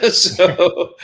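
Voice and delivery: short high burst of laughter; high, loud laughing burst. Real, not acted. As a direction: laugh hard at the punchline. Laugh after punchline.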